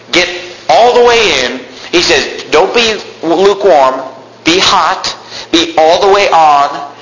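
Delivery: rising, insistent sermon delivery; fervent preaching. Acted. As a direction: preach it as Jesus calling them in. Preaching Jesus' words.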